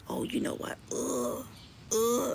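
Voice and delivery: hushed mocking imitation of him grumbling; imitating rival's grumble softly. Mimicking rival in car.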